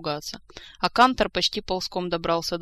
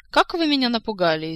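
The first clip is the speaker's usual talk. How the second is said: raised, louder sarcastic lilt; mocking; 5 semitones higher, 6 dB louder than usual. A narrator's reading. Stubborn Saeta taunts the man lecturing her, mockingly. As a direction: feign being scared to taunt them. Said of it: "издевательски отозвалась упрямая девица".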